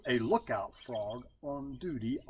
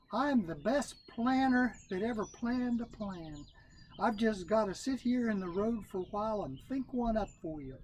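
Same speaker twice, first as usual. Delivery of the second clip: Brother Rabbit; 8 semitones higher than usual. Acted. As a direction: brag like the best planner around.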